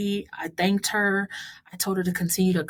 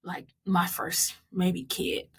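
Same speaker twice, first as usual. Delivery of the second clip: usual speaking voice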